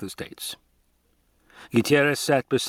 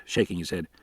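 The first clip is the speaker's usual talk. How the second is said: ordinary delivery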